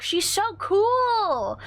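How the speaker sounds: high, slow squealed praise; excited gushing. Real, not acted. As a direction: gush with fan excitement. Fangirling over Kyrie.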